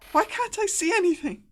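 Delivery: high, loud cry of alarm; panicked girl's voice. Acted. Game master voices the girl in the caster's head, panicked cry. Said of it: "the voice in your head goes".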